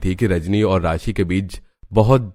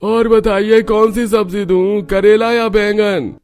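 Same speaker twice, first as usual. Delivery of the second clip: high, slow, louder vendor's call; vegetable seller; 13 semitones higher, 6 dB louder, slower than usual. Acted. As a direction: offer vegetables to the customer. (सब्ज़ीवाले की ऊँची धीमी आवाज़)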